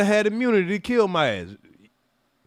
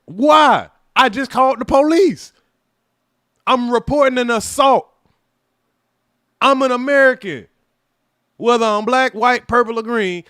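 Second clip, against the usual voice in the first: indignant outburst; 5 semitones higher, 6 dB louder than usual. Real, not acted. Indignant appeal.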